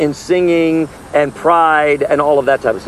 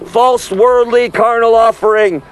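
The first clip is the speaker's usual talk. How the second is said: raised, higher preaching voice calling the crowd to repent; street preaching; 7 semitones higher, 3 dB louder, slower than usual. Acted. Street preacher warning the crowd away from the festival, preaching at full force. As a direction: preach it out to the passers-by, slowed on the key lines. Closing exhortation to the audience.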